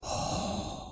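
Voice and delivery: soft unvoiced haaa breath; breathy heart sound. Shown as a demonstration. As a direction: breathe out warm air as if cold. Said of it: "like you are very cold".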